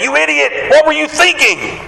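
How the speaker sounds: high-pitched, exasperated mock rebuke; mock scolding. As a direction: pretend to berate someone in a high voice. Imagined scolding of sinking Peter.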